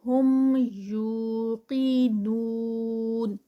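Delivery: ہم یوقنون with long یو and heavy قی; slow recitation. Shown as a demonstration. Teacher models the stretch, slow recitation. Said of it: "ٹو counts".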